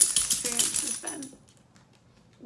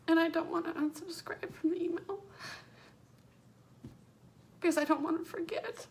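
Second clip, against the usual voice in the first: tearful, voice breaking, higher and louder; speaking through real tears; crying; 6 semitones higher, 3 dB louder than usual. Real, not acted. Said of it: "Sorry for ugly crying".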